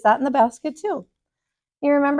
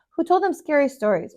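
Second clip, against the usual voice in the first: ordinary delivery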